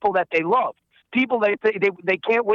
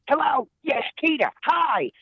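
a little higher, more amped-up Mort; amped-up Mort Goldman; 7 semitones higher than usual. Retold or imitated. Voice actor showing Mort's voice versus Saul's, amped up. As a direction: perk it up a notch, a touch more eager. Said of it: "He's just a little bit more amped up".

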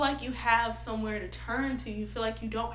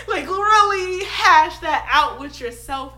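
laughing; 6 semitones higher, 14 dB louder than usual. Real, not acted. Laughs after quote.

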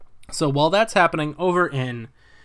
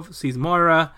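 ordinary delivery

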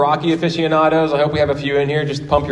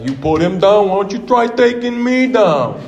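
higher, put-on Rocky voice building to a yell; movie boxer's taunt; Rocky impression; 7 semitones higher than usual. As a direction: challenge him as Rocky, stretching the end. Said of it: "He's like".

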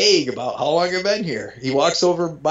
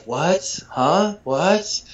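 mock groggy waking; 6 semitones higher, much slower than usual. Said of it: "put my best, you know, Tylenol P M face on".

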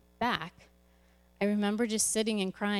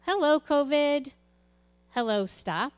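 sarcastic greeting; 6 semitones higher, 6 dB louder than usual. Real, not acted. Sudden stop of plans by covid.

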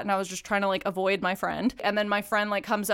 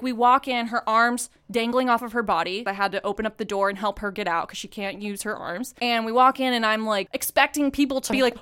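ordinary delivery

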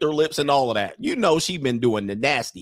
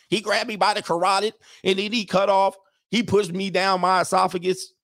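higher, hammed-up grievance; mock accuser; 6 semitones higher than usual. Comic as a woman accusing years later, mock-aggrieved. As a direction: imitate an aggrieved woman testifying.

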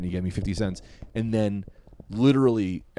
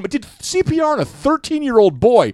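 higher, louder, fast indignant rant; heated rant; 10 semitones higher, 7 dB louder than usual. Real, not acted. Comic venting about a manager who yelled, heated and fast. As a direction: let the outrage spill out quickly. Ranting about the bad job.

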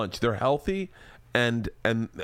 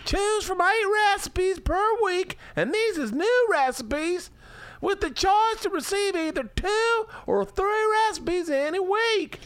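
hammed-up Southern accent; slower than usual. Host mocks wife reading meal plans in a Southern accent. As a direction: stretch each word in a Southern drawl. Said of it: "I wish I hadn't done it in that accent".

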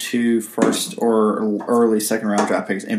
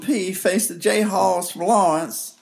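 put-on Southern accent; 9 semitones higher than usual. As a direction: overdo a Southern announcer accent. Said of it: "Do this in a Southern accent".

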